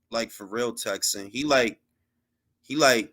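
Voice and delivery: normal talking voice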